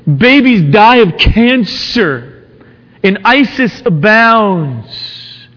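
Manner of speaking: high, urgent, rising sermon voice; impassioned preaching. Acted. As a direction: press each question harder. Preacher builds the problem of evil.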